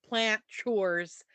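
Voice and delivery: usual speaking voice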